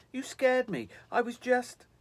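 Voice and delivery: high, loud, flustered protest; startled. A narrator's reading. Visitor telling the girl she scared him, startled. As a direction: gasp it out, still jumpy from the shock. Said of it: "he said, clutching at his chest".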